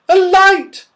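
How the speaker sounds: high, loud cry of astonishment; astonished. Acted. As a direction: gasp it out as she spots the light. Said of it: "she said in astonishment".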